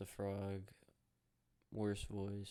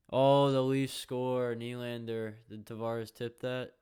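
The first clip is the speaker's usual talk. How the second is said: loud, higher, slow thrill at a goal; real excitement; 3 semitones higher, 13 dB louder, slower than usual. Real, not acted. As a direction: cheer as the puck goes in. Watching the Leafs score.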